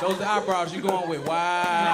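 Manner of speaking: long, loud mock-appalled wow; scornful mimicry. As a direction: stretch it out in exaggerated disapproval. Same scornful wow at eyebrows.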